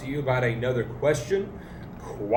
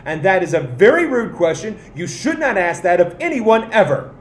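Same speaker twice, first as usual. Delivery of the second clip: mock scandalized; 4 semitones higher, 7 dB louder than usual. Mock scandal about rude question.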